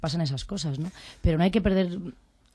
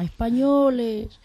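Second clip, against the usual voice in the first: "españoles" drawn out slowly, higher and louder; Franco impression; 6 semitones higher, 6 dB louder, much slower than usual. Actress mimicking Franco calling the nation, drawn-out and slow. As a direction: stretch out "españoles" with Franco's drawl. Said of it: "Con ese tono".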